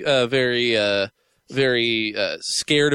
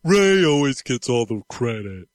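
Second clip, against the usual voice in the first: slow, lightly voiced Brad Garrett whine; Brad Garrett impression; 2 semitones lower, slower than usual. Joining the Brad Garrett bit, slow jealous brother voice. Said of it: "Brad Garrett bit".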